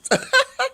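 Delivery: loud squealing laugh; loud high laugh. Real, not acted. Laughing at her own hiccups.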